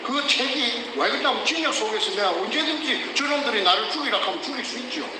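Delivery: ordinary delivery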